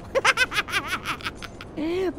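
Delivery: long, low-pitched chuckling run; giggling fit. Real, not acted. Player laughing at grabbing two coins, giggling. Laughing at own bad luck.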